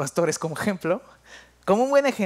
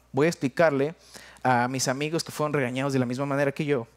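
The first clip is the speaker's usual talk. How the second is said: usual speaking voice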